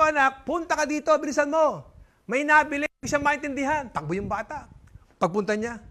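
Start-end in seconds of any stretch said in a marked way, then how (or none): 0.0-3.9 high, calling out to son; raised-pitch calling dad; father calling. Acted. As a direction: summon the kid urgently. Father calls again.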